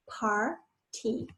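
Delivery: "Par, T" as two separate syllables. Shown as a demonstration. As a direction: split party into "Par" and "T" with a gap. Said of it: "par, that's the par".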